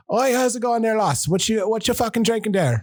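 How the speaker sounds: mock Irish pub greeting; lightly faked Irish lilt; Irish accent. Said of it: "put on a fake fucking accent".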